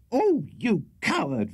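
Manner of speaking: high, slow, drawn-out scorn; supreme contempt. A narrator's reading. Wife to her husband who won't act, contemptuous. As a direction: stretch the insult out contemptuously. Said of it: "with supreme contempt".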